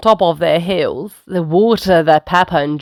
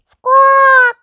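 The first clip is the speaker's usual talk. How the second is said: very high, loud bird screech; parrot squawk; 7 dB louder than usual. A narrator's reading. Polly the parrot interrupting, loud high squawk.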